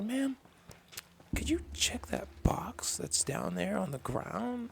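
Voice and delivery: high-pitched, slow imitation of own request; high-voiced reenacted customer question; retold polite request. Acted polite request to store clerk.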